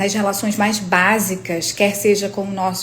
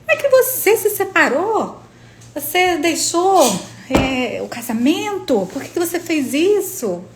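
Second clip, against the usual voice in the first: higher, scandalized busybody voice; imitating nosy acquaintance; 7 semitones higher than usual. To the narrator about her separation, a mocking higher busybody voice. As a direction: parody her prying disbelief, pitched up.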